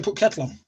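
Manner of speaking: Sheffield "Put kettle on", no article before "kettle"; dropped "the" dialect. Shown as a demonstration. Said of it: "We would drop the "the".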